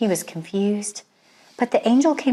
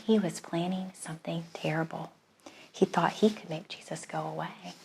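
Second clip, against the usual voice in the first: softer, whispered like a dark secret; 3 semitones lower, 12 dB quieter than usual. A narrator's reading. Narrator to children revealing Herod's plan, soft whisper. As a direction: hush it as if sharing something sinister.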